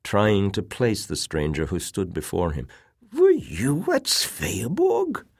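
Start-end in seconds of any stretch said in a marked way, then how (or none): 3.1-5.2 slow, high, feeble question; weak old man. A narrator's reading. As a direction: ask haltingly as a sick old man. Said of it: "he said weakly".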